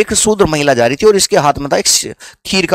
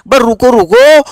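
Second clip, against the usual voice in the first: पुकारकर रोकना; 6 semitones higher, 5 dB louder than usual. Acted.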